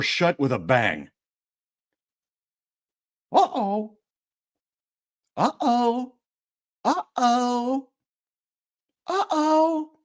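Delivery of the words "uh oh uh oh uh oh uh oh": high chirped uh-oh; chirpy Pepper uh-oh; chirping uh-oh. Acted. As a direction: chirp it up, worried but cute. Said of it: "chirps Pepper".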